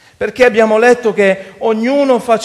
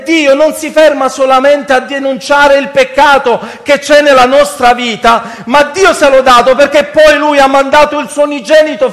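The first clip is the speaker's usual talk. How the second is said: impassioned, raised-pitch sermon; preaching; 6 semitones higher, 6 dB louder than usual. Real, not acted. Preacher asking brothers why they wait to cry out, preaching. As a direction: challenge the congregation with full force.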